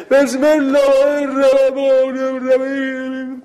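lazy drawn-out prayer. Shown as a demonstration. Teacher showing kids how not to pray, drawn-out mock recitation. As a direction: stretch every word like a sleepy careless worshipper. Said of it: "درست آدم اینجوری نماز بخونه".

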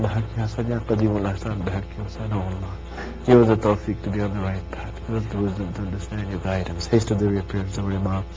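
soft, hushed prayer; closing prayer. Real, not acted. Preacher praying for the gathered crowd, softly. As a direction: pray softly to close.

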